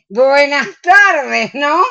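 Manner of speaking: slow high mocking greeting; sarcastic drawn-out greeting to latecomer. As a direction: needle her for arriving late. Sarcastic greeting to late friend.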